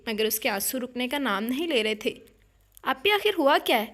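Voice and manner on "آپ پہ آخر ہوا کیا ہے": louder, urgent demand; shaking her, demanding. A narrator's reading. Sister shaking crying sister, louder urgent demand. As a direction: grab her and demand an answer. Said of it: "تقریباً اسے جھنجھوڑ دیا تھا".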